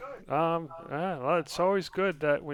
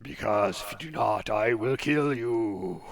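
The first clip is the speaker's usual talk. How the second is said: menacing fortune teller; 3 semitones lower than usual. Acted. GM voices fortune teller threat.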